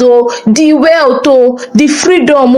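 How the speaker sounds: plain reading voice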